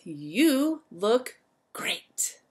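slow, hushed whispered praise. Acted. Teacher praising the tidy giraffes in a slow whisper.

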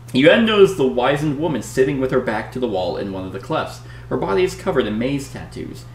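dramatic read-aloud narration; story narration. Acted. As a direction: read it like an adventure gamebook. Reads the gamebook story aloud.

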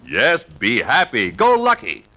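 usual speaking voice

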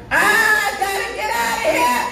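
high, slow, half-sung wail; preacher's cry. Real, not acted. Preacher longs to leave for heaven, high chanted cry. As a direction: cry it out high, stretching each word.

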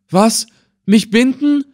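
loud, high-pitched indignant cry; shocked exclamation. A narrator's reading. Old man hearing he will be bound, crying out. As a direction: exclaim in disbelief, voice shooting up high. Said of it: "rief der Alte aus".

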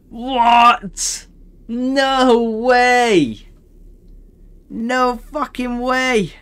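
high, loud, stretched-out despair; loud disbelief. Real, not acted. Gamer wailing to viewers about dying in a tier two. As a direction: wail about the stupid death. Still raging after death.

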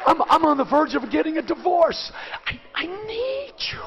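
a man muttering his shame in the pew; muttered under breath. Retold or imitated. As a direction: confess it quietly under your breath. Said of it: "under my breath I'd be saying".

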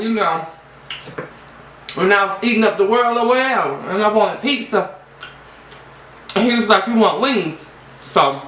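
chewing while speaking; talking with food in mouth. Real, not acted. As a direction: salad bite in mouth. Seen 1: Loaded fork at mouth; lips pursed, chewing between words.

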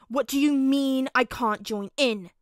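loud, drawn-out outraged snarl. A narrator's reading. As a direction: snarl it in indignant disbelief. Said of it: "he snarled".

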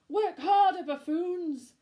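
loud, high angry bark; witch yelling. Acted. Witch shouting at the pumpkins, loud and angry. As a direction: bellow at the lazy pumpkins. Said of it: "witch shouting orders".

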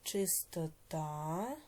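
words drawn out one by one; slow dictation. Shown as a demonstration. Teacher dictating word pairs to pupils, slowly. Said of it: "teacher dictates words slowly".

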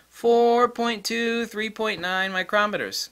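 high-pitched, deliberately stretched-out figures; really slowly. Shown as a demonstration. Vlogger repeating the key limit, really slowly. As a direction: draw out the numbers so they sink in. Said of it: "Let's repeat that really slowly".

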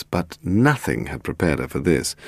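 usual speaking voice

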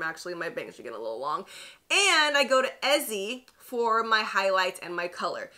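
ordinary talk

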